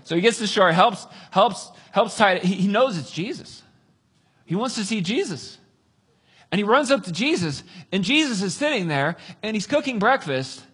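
high, urgent dramatic storytelling; impassioned preaching. Real, not acted. Preacher tells Peter's rush to Jesus, high impassioned delivery. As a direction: build the excitement for the congregation. Preaches the reunion with rising intensity.

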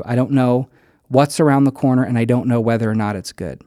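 ordinary delivery

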